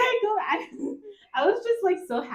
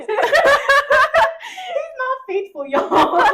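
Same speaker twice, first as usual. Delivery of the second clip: laughing; 12 semitones higher, 9 dB louder, much slower than usual. Real, not acted. Joking about the idol flirting.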